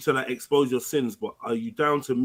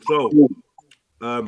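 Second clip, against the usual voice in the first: talking while eating; mouth full; 2 semitones lower, 8 dB louder than usual. Real, not acted. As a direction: speak through a mouthful of food. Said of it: "Yeah, I'm eating".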